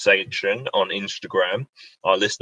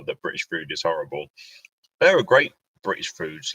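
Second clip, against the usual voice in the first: ordinary delivery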